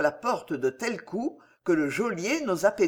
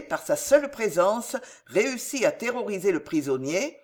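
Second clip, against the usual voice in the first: plain reading voice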